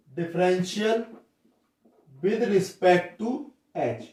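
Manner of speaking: very slow, word by word; dictating slowly to the class; slow dictation. Shown as a demonstration. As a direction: spell it out for students to copy. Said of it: "यानी H के सापेक्ष".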